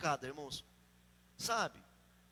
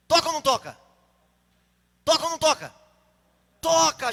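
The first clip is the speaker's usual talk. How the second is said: loud, raised demand for an answer; insistent challenge; 5 semitones higher, 11 dB louder than usual. Real, not acted. Preacher pressing the congregation to respond, loud insistent questioning. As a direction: fire the question at the crowd.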